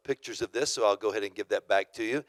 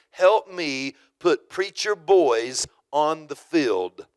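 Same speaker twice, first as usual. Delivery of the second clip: slow, louder, every word of the slogan weighed; proclaimed slogan; 2 semitones higher, 6 dB louder, slower than usual. Retold or imitated. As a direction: announce it like a banner slogan. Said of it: "That was the whole slogan".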